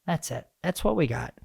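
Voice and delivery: ordinary delivery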